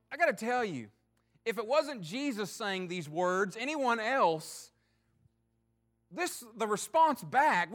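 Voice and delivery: normal talking voice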